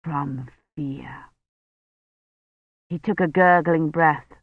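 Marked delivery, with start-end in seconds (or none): 0.0-1.2 much softer but steadier; a touch clearer dying voice. A narrator's reading. Dying Roche comforts Kivrin, soft but clearer. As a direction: gather a little strength. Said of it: "his voice sounded clearer".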